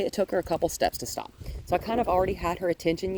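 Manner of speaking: ordinary delivery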